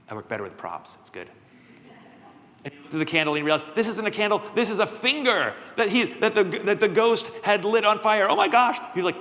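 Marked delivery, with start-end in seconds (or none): 3.8-8.8 higher, rising panic; alarmed realization. Acted. Jack realizes the candle is a burning finger, rising alarmed panic. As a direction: let the horror build as it sinks in.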